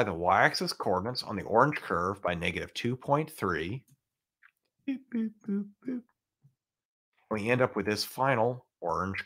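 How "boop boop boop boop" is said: high squeaky cartoon boops; silly clown. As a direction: chirp each boop like a toy. Cartoon sound effect in lesson.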